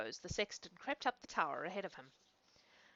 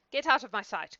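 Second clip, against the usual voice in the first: angry dismissal; 3 semitones higher, 8 dB louder than usual. A narrator's reading. Father banishes the boy.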